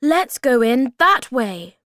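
rally the others to follow; urging. A narrator's reading. Moomin Troll leads friends towards the cellar, eager call.

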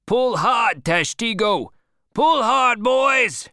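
high, loud shout building in force; cried out. A narrator's reading. Boat leader urging his rowers after the whale, shouting. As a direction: urge the rowers on at full voice. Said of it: "cried Stubb".

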